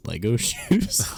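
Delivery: amused disbelief with a laugh; incredulous. Real, not acted. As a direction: ask it as if you can't believe it.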